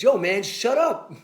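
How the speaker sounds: mock yell from a lineman, drawn out; retold yell. Lineman yelling shut up at the player, mock shout. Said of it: "still screaming at Jeff Saturday".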